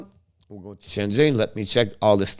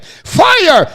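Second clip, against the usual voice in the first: excited call; 8 dB louder than usual. Real, not acted. Shot on goal, sudden call.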